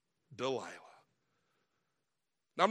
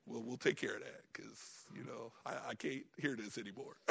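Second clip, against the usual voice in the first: a hint of a weary husband; 5 semitones lower than usual. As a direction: give in to her with a weary chuckle. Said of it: "It's like".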